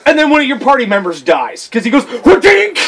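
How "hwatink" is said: high, loud cartoon attack sound; sudden high vocal sound effect; punchy mouth-made weapon noise; imitated attack noise.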